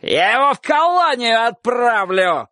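high, drawn-out indignant yell; shouted threat. A narrator's reading. Dog-catcher threatening to send boy away, shouting. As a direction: stretch the threat in a high cry. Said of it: "кричал Собаколов".

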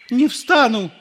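very high, loud refusal; kneeling priest refusing to stand; stubborn priest. A narrator's reading. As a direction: dig in and refuse.

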